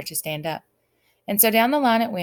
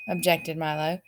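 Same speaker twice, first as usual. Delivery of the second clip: ordinary delivery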